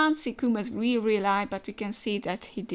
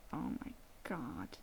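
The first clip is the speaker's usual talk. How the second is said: very soft, low whispered dismay; horrified whisper; 8 semitones lower, 14 dB quieter than usual. Acted. Mother reacting as her family is threatened, horrified whisper. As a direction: whisper it in dread.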